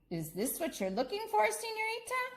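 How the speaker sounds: sly question, rising high on señorita; playful manager voice. Acted. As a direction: tease the girl with a sudden high señorita. Manager voiced, finds bear.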